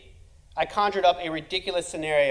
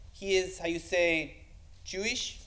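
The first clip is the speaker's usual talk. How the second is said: put-on Spanish accent; 7 dB quieter, slower than usual. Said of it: "[name] speaks Spanish accent".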